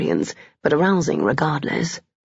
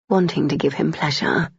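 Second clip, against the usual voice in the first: ordinary delivery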